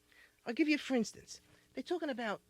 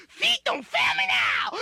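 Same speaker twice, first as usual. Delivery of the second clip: much higher, slow, terrified squeal; scared shriek; 8 dB louder, slower than usual. Retold or imitated. Frightened chauffeur sees a skeleton, slow high terrified squeal. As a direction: shriek it as you flee a skeleton. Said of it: "see a skeleton and say".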